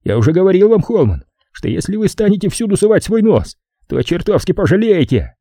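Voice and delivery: high, heated character voice; Edgar, angry threat. Acted. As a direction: warn him off, voice pitched well up. (сердитая угроза, высоким голосом)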